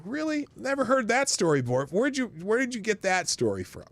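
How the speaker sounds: high, mock-astonished sarcasm; sarcastic disbelief. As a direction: feign shock, dripping with sarcasm.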